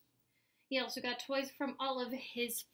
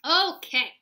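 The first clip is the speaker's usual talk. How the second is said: loud, higher-pitched giving in; exasperated; 4 semitones higher, 12 dB louder than usual. A narrator's reading. Mom gives in to Spencer, loud and exasperated. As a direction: concede it loudly, fed up.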